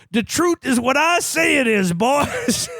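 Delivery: high, hammy blustering senator shouting; mock Southern-senator bellow; smug senator pronouncement. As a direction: ham up a crooked senator laying down the law. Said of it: "Ned Beatty literally says".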